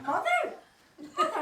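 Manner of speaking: high, loud delighted Mother; surprised cry. Acted. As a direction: call out in startled joy. Mary recognising dead mother calling.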